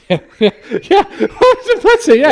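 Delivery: high, loud laughter; helpless laughing; laughing hard. Real, not acted. Laughing at the milkman joke.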